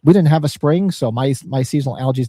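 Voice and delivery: ordinary talk, nothing marked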